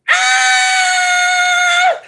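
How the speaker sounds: shrill loud scream, pitch way up; sudden high yell of exasperation; high frustrated scream. Real, not acted. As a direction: let out a piercing scream.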